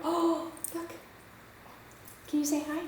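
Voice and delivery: high soft puppy talk; talking to a dog. Real, not acted. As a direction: gently coax the dog. Coaxing the puppy.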